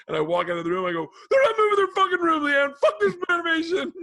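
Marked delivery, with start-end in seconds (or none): 1.3-3.9 tearful yell, much higher and louder; angry crying shout. Retold or imitated. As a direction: shout at Leanne through tears. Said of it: "I start to get angry".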